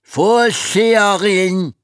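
loud drawn-out bellow. Acted. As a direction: bellow it down the corridor. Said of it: "hörte er sie brüllen".

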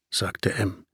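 plain reading voice

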